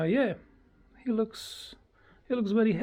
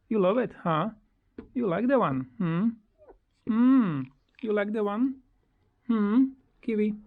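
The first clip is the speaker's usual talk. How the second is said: high, bright hums and questions; cooing pet talk; 6 semitones higher, 3 dB louder than usual. Real, not acted. Owner coos to bird in its cage, high and sing-song.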